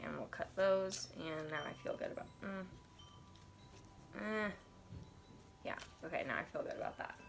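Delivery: ordinary talk, nothing marked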